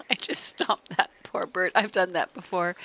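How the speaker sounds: loud laugh through the words; laughing out loud at her own blunder. Real, not acted. Laughing at stomping the bird.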